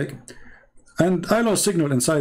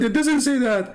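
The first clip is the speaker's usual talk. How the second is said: retold denial; 8 semitones higher than usual. Questioner denies the claim.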